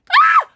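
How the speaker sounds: very high, loud squeal of joy; delighted scream. Real, not acted. Meets the pet she wanted.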